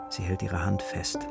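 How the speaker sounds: normal talking voice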